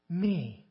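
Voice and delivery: "me." dropped low and soft, drawn out; stress on me. Shown as a demonstration. Preacher pointing out Jonah's selfishness, "me." low and soft. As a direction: let "me." fall low and quiet. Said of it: "the angry prophet".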